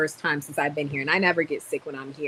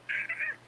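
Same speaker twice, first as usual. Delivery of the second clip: mock war cry pitched way up; high yell; 7 dB quieter than usual. Retelling the moment she blew at him, high mock yell. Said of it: "I was like".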